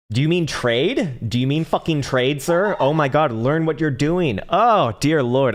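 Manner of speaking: stunned, fed-up scolding of a viewer; exasperated disbelief. Real, not acted. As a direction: let them hear how much the question annoys you.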